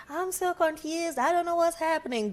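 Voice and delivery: high-pitched, mimicking a helpless complainer; mock confusion. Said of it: "still here you're like".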